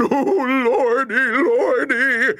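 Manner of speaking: high, loud quavering wail; hammed-up terrified wail; shivering moan. A narrator's reading. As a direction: moan high, shaking with terror. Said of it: "shivered and shook and moaned".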